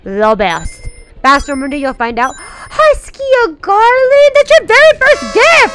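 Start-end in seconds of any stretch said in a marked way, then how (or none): 2.4-5.7 high, loud, thrilled thanks; gushing high-pitched excitement; excited shriek. Real, not acted. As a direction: squeal with delight at a gift. Thanks viewer for first gift.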